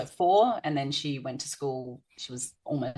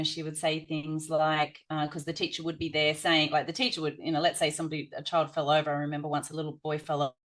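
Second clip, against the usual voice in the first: usual speaking voice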